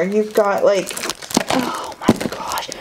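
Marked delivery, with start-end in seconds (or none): 1.5-2.7 soft whispered amazement; awed whisper. Real, not acted. Finding another item in the box, awed whisper. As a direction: breathe it out in disbelief.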